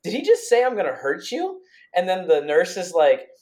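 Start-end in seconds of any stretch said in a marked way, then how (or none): 0.0-1.6 retold disbelief. Partner watching the doctor, high stunned disbelief. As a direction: squeak it out in disbelief. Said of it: "I was just like".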